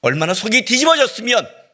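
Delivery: loud, high emphatic cry; anguished outburst. Acted. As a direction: build to a churning, exasperated peak. Climax of imagined anguish before hush.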